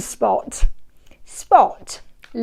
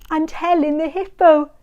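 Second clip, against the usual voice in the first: ordinary delivery, nothing marked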